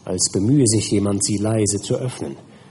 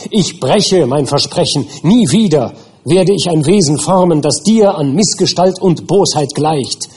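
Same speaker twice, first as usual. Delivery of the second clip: higher and louder, flinging the refusal; shouting defiance; 7 semitones higher, 6 dB louder than usual. Acted. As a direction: defy the monster at full voice. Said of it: "schrie ich ihn an".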